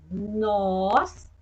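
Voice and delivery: Noz with a held nasal N; showing children the N in noz. Shown as a demonstration. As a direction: model the N sound in noz. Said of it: "o N tem o mesmo som".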